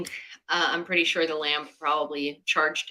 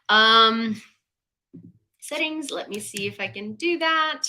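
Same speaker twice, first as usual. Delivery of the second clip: ordinary delivery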